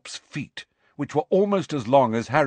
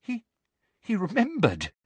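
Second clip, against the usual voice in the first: put-on shaky frightened girl; 10 semitones higher than usual. Hermione about to faint.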